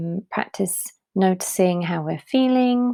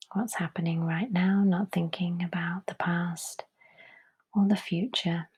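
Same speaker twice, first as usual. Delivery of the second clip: hushed, unhurried calm; guided meditation; 5 dB quieter than usual. Real, not acted. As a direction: gently guide them into letting go, softly. Leads a breathing meditation.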